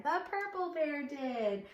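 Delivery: ordinary delivery, nothing marked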